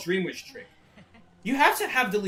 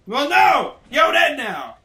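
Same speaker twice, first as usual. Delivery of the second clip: loud, high gamer outburst; excited yell; 9 semitones higher, 13 dB louder, slower than usual. Real, not acted. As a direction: shout it at the screen. Yelling at the game.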